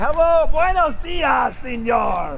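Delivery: high, showy hail to a colleague; effusive greeting. Pretend. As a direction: call him over with delighted fanfare. Calls out greeting to new professor.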